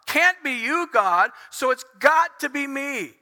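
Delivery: high, emphatic reasoning turned on oneself; anguished self-blame. Acted. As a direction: argue it out in frustrated distress. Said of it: "so he reverts and he goes".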